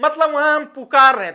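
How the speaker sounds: ordinary talk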